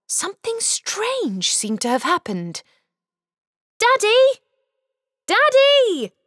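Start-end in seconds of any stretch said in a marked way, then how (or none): 3.8-4.4 high, loud call with a rising end; shouted. Acted. Boy shouting for his dad, high rising call. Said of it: "Arthur shouted". 5.3-6.1 high, loud call falling at the end; shouted. Acted. Boy shouting again for his dad, falling call. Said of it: "Arthur shouted".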